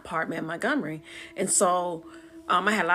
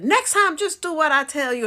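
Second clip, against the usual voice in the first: high, loud told-you-so scolding; scolding holler; 9 semitones higher, 8 dB louder than usual. Retold or imitated. Twin sister scolds her after the find, hollering. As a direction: scold loudly, starting high and easing down. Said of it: "my sister hollered at me".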